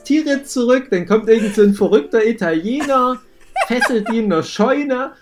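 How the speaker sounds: giggling through a silly story; laughing, high and excited. Real, not acted. Comic retelling of alternate ending.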